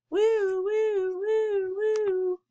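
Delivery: long, very high siren wail; siren imitation. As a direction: wail like a fire engine going by. Fire truck siren passes.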